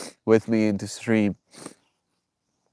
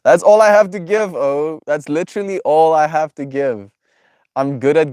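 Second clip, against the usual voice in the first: excited; 6 semitones higher, 7 dB louder than usual. Real, not acted. Gushing about giving love.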